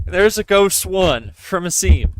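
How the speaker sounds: usual speaking voice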